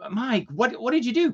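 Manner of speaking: high incredulous demand; roommate aghast. Retold or imitated. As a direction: gape at the hole in the ceiling. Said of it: "he said".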